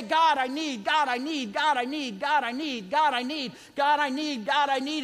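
mock needy prayer, rattled off in identical beats; repetitive begging prayer. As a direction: imitate someone nagging God with the same plea.

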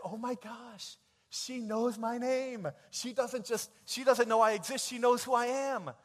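put-on giddy boy. Boy gushing that his crush knows his name, loud and giddy. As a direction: gush like a lovestruck teen. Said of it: "And I said".

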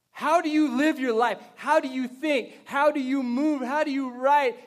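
loud, high, driving preacher questions; preaching. Real, not acted. Pastor challenging church on how they live, forceful preaching. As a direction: fire off each question with force.

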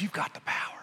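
hushed whisper, as the tempter; soft, voiceless whisper; whispered temptation. Acted. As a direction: whisper it like a secret lure. Satan tempting Jesus, first take.

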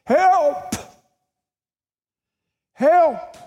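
high, drawn-out call for help; loud, high-pitched distant cry. Acted. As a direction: holler for rescue like someone lost in the mountains. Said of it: "he cups his hands and he cries out for help".

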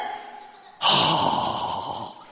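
long, loud exhale; exasperated sigh. Acted. Dad dragged from the game a third time, sighing loudly. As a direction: heave a big weary sigh.

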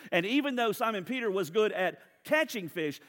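ordinary delivery

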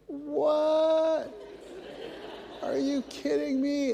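high, incredulous "what, are you kidding me"; the grumpy inner voice protesting; annoyed me. Retold or imitated. Inner grumbling self protesting the late errand, high incredulous. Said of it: "There's the me that goes".

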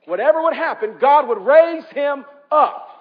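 preacher repeating it with full force; emphatic repeat. Acted. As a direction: drive the line home to the congregation.

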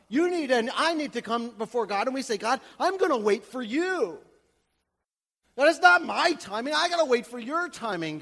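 loud, high impassioned sermon; preaching at full force. Acted. Pastor rousing the congregation, loud fervent preaching.

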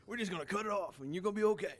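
lightly voiced wrestling-announcer doctor, fast; a hint of a WWE announcer voice for the doctor. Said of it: "like he's, like, on W W E or something".

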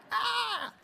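high, sharp exclamation; sudden shout. Acted. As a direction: burst out with a high cry.

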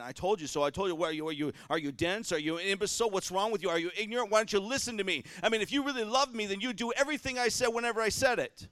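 rising mock tirade at a child; mock nagging. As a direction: ham up an exasperated parent. Mocking a nagging parent.

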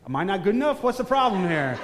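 hammed-up indignant complaint; mock offended. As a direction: play wounded pride for laughs. Acts his jealous inner voice, joking.

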